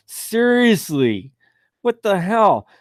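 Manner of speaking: high, drawn-out disbelief; stunned at the wording; incredulous. Real, not acted. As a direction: react like you can't believe what you just read. Host mocks absurd legal notice.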